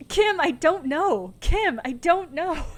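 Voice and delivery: loud, high, drawn-out panic; panicking. Real, not acted. Player flounders to Kim after the prank call, panicking. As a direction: plead helplessly, stretching the words out. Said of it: "I was too busy panicking".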